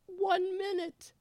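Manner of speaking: playful falsetto. Pretend. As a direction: stretch it out high and playful. Host calling for music cue.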